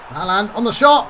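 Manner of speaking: loud, high, climbing commentary burst; excited call. Real, not acted. Gamer commentating as Haaland shoots, excited. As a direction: build urgently towards the shot. Calling a shot live.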